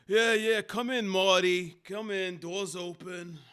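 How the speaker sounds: high, booming careless boss; loud boss voice. Acted. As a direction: bellow like a lazy boss. Boss voice calling him in.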